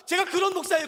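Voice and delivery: ordinary talk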